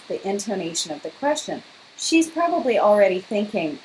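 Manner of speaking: ordinary delivery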